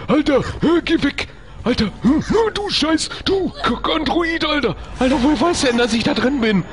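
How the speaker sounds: high breathless panicked rant; frantic panic. Real, not acted. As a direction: scramble in fear. Android found his hiding place.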